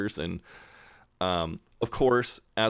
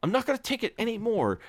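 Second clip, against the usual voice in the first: high, strained, fast boiling-over rant; man finally snapping at the mugger; worked-up outburst; faster than usual. Retold or imitated. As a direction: let the anger burst out high and fast. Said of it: "working himself up".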